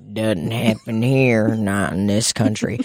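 low, slow imitation of closed-minded church people; mock deniers. Church people denying lesbians exist, mocked in a low slow voice. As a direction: drop the pitch and drag out the denial. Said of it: "They're like".

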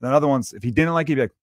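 ordinary delivery

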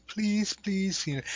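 high, pleading mock whine; mock begging. Preacher mocking someone begging God, high mock pleading whine. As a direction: beg in a thin, pathetic voice.

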